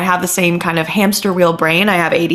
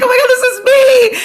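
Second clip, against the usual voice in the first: very high, louder squeal; excited recognition; 6 dB louder than usual. Retold or imitated. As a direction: squeal it like you can't hold it in. Acts out excited urge to interrupt.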